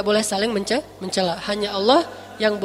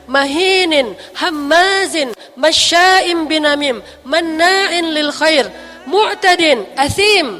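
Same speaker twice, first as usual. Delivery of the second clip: angry Quran recitation; 2 semitones higher, much faster than usual. Shown as a demonstration. Teacher reciting the verses with a stern edge. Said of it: "kalau kita baca tuh iramanya kurang lebih".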